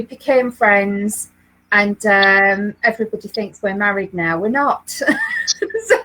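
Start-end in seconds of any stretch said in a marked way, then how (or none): 5.1-5.8 high bursting laugh; real laugh at the joke; laughter. Real, not acted.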